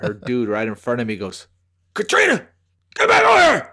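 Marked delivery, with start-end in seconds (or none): 1.9-3.7 sharp high shout across the store; man shouting for his girlfriend across the gas station; yelled name. Retold or imitated. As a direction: bark her name to call her back. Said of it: "Dude, just like that".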